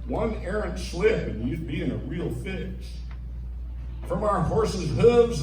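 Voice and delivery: ordinary delivery, nothing marked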